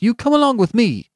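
loud, very high treble command; indignant order. A narrator's reading. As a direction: demand it indignantly, voice shooting up. Benjamin orders the colonel along.

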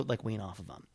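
ordinary delivery

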